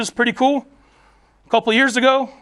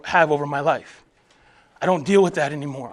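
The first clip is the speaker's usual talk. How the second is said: usual speaking voice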